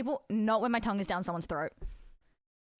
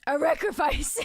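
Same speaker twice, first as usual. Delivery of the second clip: ordinary talk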